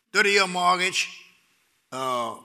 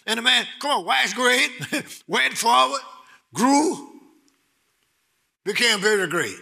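higher, louder shouting joy; ecstatic preaching; 9 semitones higher, 5 dB louder than usual. Acted. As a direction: shout the verse in delight.

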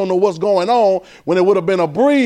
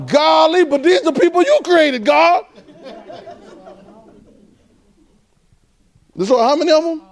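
mock exasperation; 9 semitones higher than usual.

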